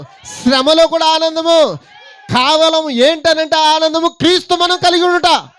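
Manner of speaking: rally the church like a revival shout; preaching at full force. Acted. Preacher rousing worshippers to shout praise, full force.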